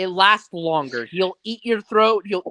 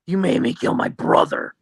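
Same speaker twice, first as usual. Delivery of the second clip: menacing; 2 semitones lower than usual. Shown as a demonstration. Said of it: "in a menacing voice".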